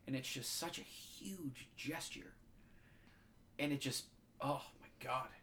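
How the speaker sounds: slow, choked-up, fighting real tears; the words catch as tears come; trying not to cry. Real, not acted. Podcaster describing the grandfather's gesture, choked up for real. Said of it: "trying so hard not to cry right now".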